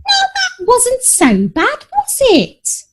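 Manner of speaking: very high, slow fussing coo; high sing-song mother soothing her cats; fussing mum. A narrator's reading. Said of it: "Mum fussed".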